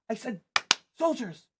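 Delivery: put-on high, bossy child's order, falling at the end; impatient little commander; insistent repeat. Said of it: "when no one does, she turns and goes".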